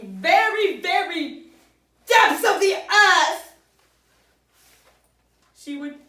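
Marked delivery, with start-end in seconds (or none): 0.0-3.5 mock grand. Grandma's stew, mock epic.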